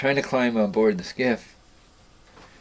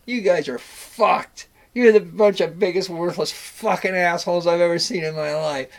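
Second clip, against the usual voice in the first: furious rant; 8 semitones higher, 6 dB louder than usual. Retold or imitated. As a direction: spit out every insult. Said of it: "and he goes".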